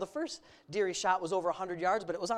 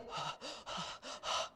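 imitated panicked breathing; shaky panting; 13 dB quieter than usual. Dad showing how his son shook with buck fever, panting. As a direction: huff out breaths like a nervous hunter.

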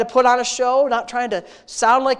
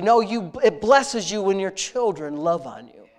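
ordinary delivery

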